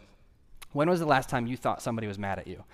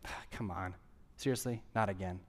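mock weary disappointment; 4 semitones lower, 10 dB quieter than usual. Voicing a disappointed God.

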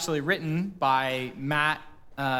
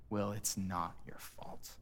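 soft tender reassurance; 5 semitones lower, 12 dB quieter than usual. Retold or imitated. Final repeat before breakdown.

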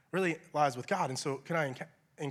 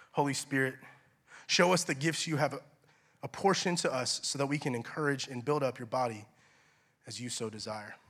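low, softening prayer voice; hushed plea to the Holy Spirit; 4 semitones lower than usual. Acted. Leads a short prayer.

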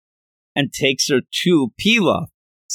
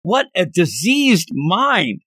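aghast; 6 semitones higher, slower than usual. Retold or imitated. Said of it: "He's like".

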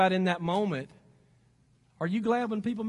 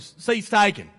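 Southern drawl impression, louder; Forrest Gump; 5 dB louder, slower than usual. Preacher quoting a film for laughs, mock Southern accent. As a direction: put on a bad Southern movie accent. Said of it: "in Forrest Gump language".

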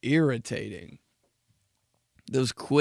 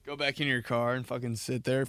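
ordinary talk, nothing marked

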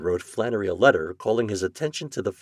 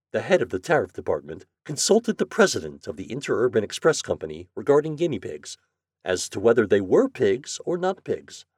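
usual speaking voice

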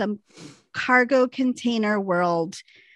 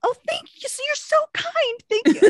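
high, sweet, grateful cooing; hammed-up polite thankfulness, pitched way up; gushing thanks; 12 semitones higher than usual. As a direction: overdo the gratitude to a kind stranger. Acting out gushing thanks to stranger.

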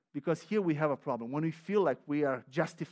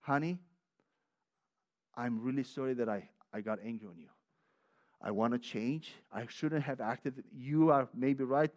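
tender apology; 4 semitones lower than usual. Shown as a demonstration. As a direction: say sorry gently, from the heart. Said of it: "go immediately".

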